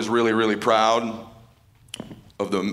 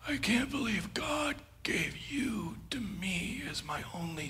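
dying father's words; 13 dB quieter than usual. Retold or imitated. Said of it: "looks at me, goes".

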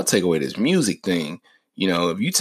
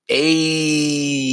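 long drawn-out, higher-pitched "ayy"; hyped "ayy"; 8 semitones higher than usual. Shown as a demonstration. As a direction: hold one high "ayy" like a fan feeling the beat. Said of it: "makes music that makes you go".

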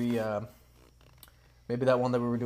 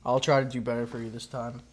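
ordinary talk, nothing marked

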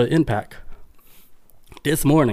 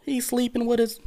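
much higher, hammed-up gasping disbelief; mock shocked viewer; 11 semitones higher, 3 dB quieter than usual. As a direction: ham up a scandalized viewer.

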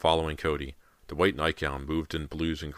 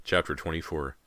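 usual speaking voice

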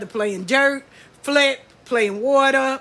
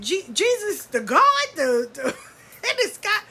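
high, stammering disbelief; can't believe what you heard; incredulous; 8 semitones higher than usual. Retold or imitated. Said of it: "I said".

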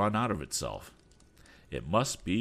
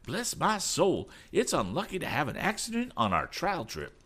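high-pitched fretting; flustered high character voice; perturbed; 10 semitones higher than usual. A narrator's reading. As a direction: fret aloud in a raised, worried pitch. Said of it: "in a perturbed voice".

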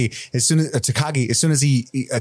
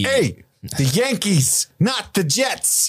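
put-on New York accent; 8 semitones higher, much slower than usual. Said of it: "tough New York cop".

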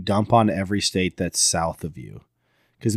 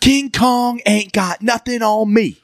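high, slow, swaggering movie boast; Denzel Washington; 12 semitones higher, slower than usual. Acted. Quoting Training Day, high slow Denzel bravado. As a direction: belt it high, stretching every word. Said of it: "Training Day".

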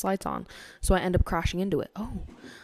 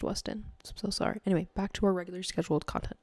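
ordinary talk, nothing marked

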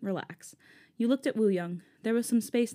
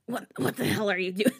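attempted raspy voice. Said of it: "I tried to do a raspy voice".

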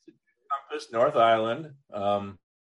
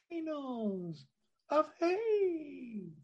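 high, soft, hammed-up trailer voice on volcanoes of hate; drawn-out mock-epic ending, then a high airy lift; dramatic sign-off; 8 dB quieter, much slower than usual. Host closing the episode, mock-epic and softly soaring.

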